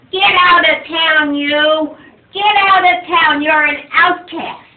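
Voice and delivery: loud furious shout; angry crowd. Acted. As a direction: drive them out of town. Angry townspeople banish the father.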